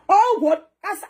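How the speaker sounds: high shocked oh what. Acted. Reacting to the crowd, high surprised shout. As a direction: jump up in shock.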